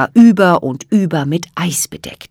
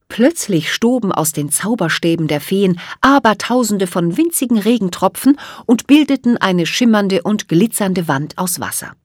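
ordinary delivery, nothing marked

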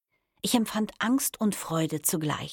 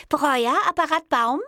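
high, louder telephone greeting; female receptionist; 11 semitones higher, 6 dB louder than usual. A narrator's reading. New intern answering the office phone, high female voice. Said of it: "sagte eine weibliche Stimme".

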